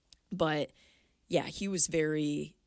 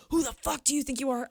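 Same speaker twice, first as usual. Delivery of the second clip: high, loud retold challenge; confrontational dad; 10 semitones higher, 5 dB louder, faster than usual. Dad confronts the guy he mistook for another, angrily. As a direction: imitate a dad getting in someone's face. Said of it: "getting in his face".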